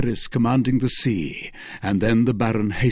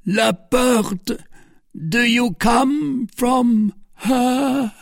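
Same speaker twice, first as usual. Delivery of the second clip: high, slow, strained question; pained, drawn-out high voice; dying voice; 13 semitones higher, much slower than usual. A narrator's reading. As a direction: ask with failing strength. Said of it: "said the Duke in a dying voice".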